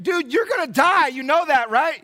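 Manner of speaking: high loud bystander pleading; mock a stunned onlooker; a shocked bystander telling Paul he's going to die, high and loud; incredulous bystander.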